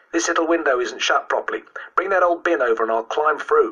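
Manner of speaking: put-on high-pitched boy giving eager instructions; bold schoolboy. Boy planning to climb in the window, bold. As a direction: play the boy taking charge. Boy takes charge outside the house.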